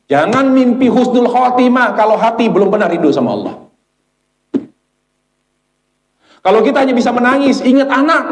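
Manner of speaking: high, forceful exhortation; impassioned preaching. Real, not acted. Preacher warning the congregation about hearts not longing for Allah, impassioned. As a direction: preach it urgently at a high pitch.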